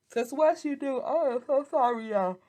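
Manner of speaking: high, loud words swamped by a yawn; real yawn mid-sentence; talking through a yawn. Real, not acted. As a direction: apologise while a big yawn takes over. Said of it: "yawning dot com".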